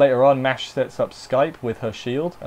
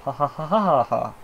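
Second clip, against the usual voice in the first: hammed-up imitation of a belly laugh. Showing viewers Gilgamesh's laugh to dub over the scene, hammed-up belly laugh. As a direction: give a big hearty belly laugh that sounds like a copy of someone else's. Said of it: "Dub that over the top".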